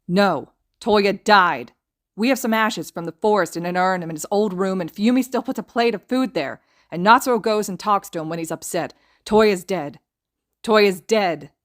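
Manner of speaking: loud, desperate screamed denial; a brother refusing the truth, screaming; anguished scream. A narrator's reading. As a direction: scream it in grief and fury. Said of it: "as Shoto screams".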